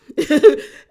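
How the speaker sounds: sudden loud burst of laughter. Real, not acted. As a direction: crack up at the drama. Laughs at her own recap.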